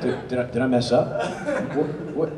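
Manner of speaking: retold genuine fear. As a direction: replay nervous self-doubt, half laughing. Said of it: "I began to get genuinely afraid".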